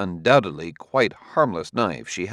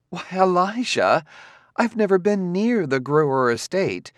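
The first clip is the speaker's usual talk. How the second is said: put-on higher young woman's voice, pleading innocence; Gladia, wounded innocence; 10 semitones higher than usual. Gladia insisting to Elijah she is innocent, pleading. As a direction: protest you never went near the estate.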